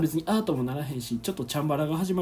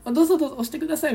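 much higher, very fast fluster; rushed polite offer; 10 semitones higher, much faster than usual. Retold or imitated. エレベーターで相手に慌てて譲る. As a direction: gabble the offer in a high rush. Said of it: "みたいな".

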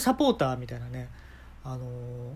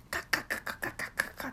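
mock chewing noise; 8 dB quieter, faster than usual. Imitating chewing.

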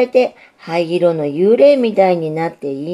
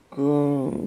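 low droning ぐーん; humming like a top; 4 semitones lower, 6 dB quieter than usual. Acted. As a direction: hum it like a spinning top. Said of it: "駒のようにうなっておりました".

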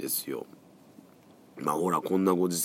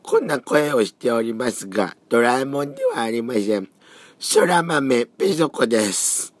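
hoarse cold voice; 5 semitones higher, 4 dB louder than usual. Real, not acted.

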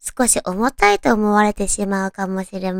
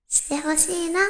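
softer, coaxing request; おねだり声; 5 semitones higher, 7 dB quieter than usual. Acted. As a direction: ask sweetly, wheedling. おねだりゼリフを演じる. (甘えておねだりする声)

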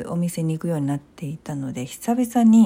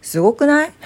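excited; 9 semitones higher, 8 dB louder than usual. Real, not acted. Proudly praising her own chores.

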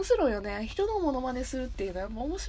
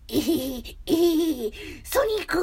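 rising, louder, higher cartoon voice building to a yelp; Sugita's Yuki Aoi impression; 6 semitones higher, 6 dB louder, much slower than usual. Host copying Sugita's take on Yuki Aoi, rising and loud. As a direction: climb up in pitch and volume into the last word. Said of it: "結城葵ちゃん".

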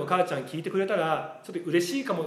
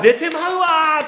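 hammed-up Osaka dialect, higher, louder and slow, laughing; 大げさな大阪弁のものまね; mock Osaka dialect; 10 semitones higher, 8 dB louder, slower than usual. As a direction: parody a coworker's fake Osaka dialect. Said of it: "とか言うんですけど".

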